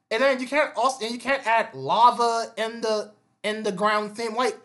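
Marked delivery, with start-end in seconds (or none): none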